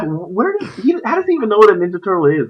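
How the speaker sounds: high-pitched retold bewilderment; baffled disbelief. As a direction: act out your baffled reaction, pitched high. Acted baffled reaction to caster's line.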